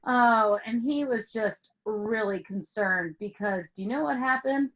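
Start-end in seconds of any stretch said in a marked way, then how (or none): none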